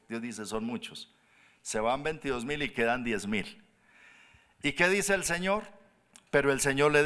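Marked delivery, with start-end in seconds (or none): 0.4-1.1 soft, matter-of-fact reply; understated insistence. Acted. God answering the doubter's objection, quietly insistent. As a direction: drop the volume and state it simply.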